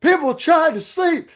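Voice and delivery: high, loud mock shout at the noisy car; retold angry yell. Middle-aged man yelling at the car blasting music, high loud shout. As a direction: yell it like a fed-up neighbour. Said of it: "And he goes".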